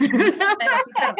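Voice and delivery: laughing, sincere self-deprecation; laughing honest admission to a colleague. Retold or imitated. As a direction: say it with a laugh, not sarcastic, slow and high at the end. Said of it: "bukan sarkastis loh".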